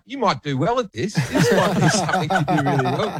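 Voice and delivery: chuckling at the maths bloke; laughing quote. Retold or imitated. Retelling his quip to a contestant, laughing as he says it. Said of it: "And I'm like".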